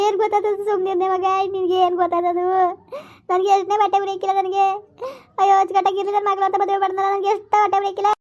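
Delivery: high-pitched, crying lament; tearful wailing. Acted. Upset mother laments her daughter's marriage, wailing. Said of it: "ಸುಮ್ಕಿರಕ್ ಅಳ್ಬೇಡ".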